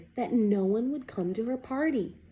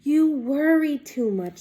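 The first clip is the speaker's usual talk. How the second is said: mother; 4 semitones higher, 6 dB louder than usual. Acted. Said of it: "said her mother".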